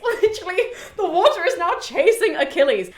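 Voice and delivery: high, loud, laughing through the words; amused, excited squeal of a telling; laughing disbelief. Real, not acted. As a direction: tell it cracking up at the absurdity. Amused at absurd chase.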